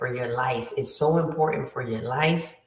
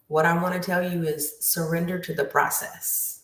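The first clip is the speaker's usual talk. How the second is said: usual speaking voice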